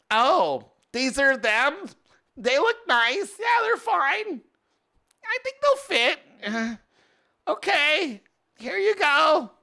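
high, slowed-down mock wife voice; imitating wife. Wife awkwardly accepting bad bookshelves from the seller, high mock voice. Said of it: "Not my wife".

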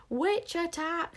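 high, loud, slow alarm cry; terrified scream. A narrator's reading. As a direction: sound the alarm in panic. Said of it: "screamed Bodkin".

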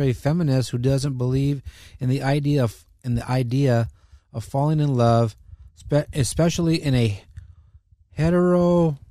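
normal talking voice

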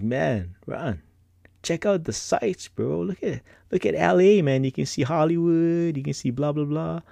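lightly voiced eager friends; friends hyping him up. Friends urging him to look at the view, lightly voiced. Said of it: "my friends are like".

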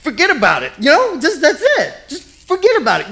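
high-pitched laughing mock mob boss; hammed-up New York mobster, forget about it; mobster impression. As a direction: Godfather voice played for laughs. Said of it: "He's the Godfather".

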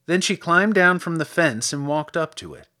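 ordinary delivery, nothing marked

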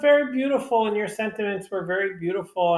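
a burst of sincere praise; earnest enthusiasm. Real, not acted. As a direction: insist it was beautiful, with real feeling. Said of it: "I don't mean that sarcastically".

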